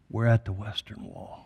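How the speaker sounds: mildly tearful imitation of the commander; weeping commander. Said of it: "Starts to weep as he says".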